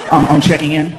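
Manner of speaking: low, sheepish reply; meek guest. Retold or imitated. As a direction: shrink before the grumpy clerk. Himself meek to clerk.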